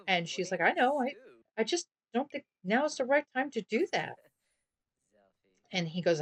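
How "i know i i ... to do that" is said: put-on higher girlish voice, gently hesitant; voiced girlfriend. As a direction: girlfriend declining to move in, high and hesitant. Girlfriend voiced in Sims story.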